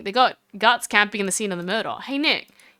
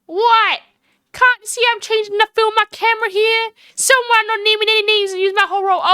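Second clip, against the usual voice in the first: high, irritated Southern drawl; 9 semitones higher, 3 dB louder than usual. Acted. Photographer grumbles at whoever used up her film, Southern drawl. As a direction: complain pointedly in a high Southern twang. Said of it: "I get to pull this voice off".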